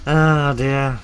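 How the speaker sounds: very loud, high, drawn-out sigh after laughing; amused wail. Real, not acted. Recovering from laughing fit.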